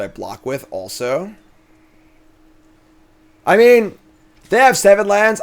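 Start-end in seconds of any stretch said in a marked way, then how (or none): 3.5-5.4 exasperated. Real, not acted. Exasperated outburst.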